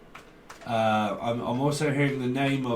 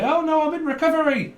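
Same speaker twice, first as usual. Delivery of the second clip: mocking quote; 14 semitones higher, 5 dB louder than usual. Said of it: "this person might say".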